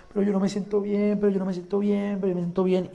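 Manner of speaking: queja repetida en voz baja, de corrido; imitando queja. Retold or imitated. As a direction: imita una cantinela quejosa y bajita. She kept complaining, insisting. (imitando una queja, bajito)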